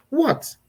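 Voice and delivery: "What" pitched very high, then dropping steeply; sharp high "What". Shown as a demonstration. Mom at her most snappy when called, sharp high "What". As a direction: fling out a high, exasperated "What". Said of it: "It comes with different tones".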